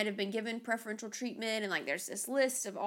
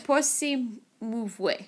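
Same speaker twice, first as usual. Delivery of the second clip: slow mangled French-Italian attempt; careful bad French-style pronunciation; foreign accent in French; slower than usual. Shown as a demonstration. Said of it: "but I still can't do it".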